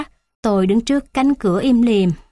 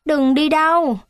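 put-on higher, childlike pleading repeat of đừng đi đâu; a child's high plea đừng đi đâu; 7 semitones higher than usual. A narrator's reading. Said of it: "Tôi chạy lại nắm chặt cánh tay bà".

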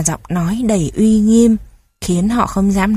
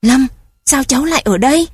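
worried; 5 semitones higher, faster than usual. A narrator's reading. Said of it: "Giọng ông dồn dập, lo lắng".

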